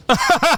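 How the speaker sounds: high, real laughing burst; burst of laughter. Real, not acted. Reacting to a suppository story, high burst of laughter. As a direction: crack up in high-pitched laughter.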